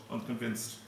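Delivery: plain reading voice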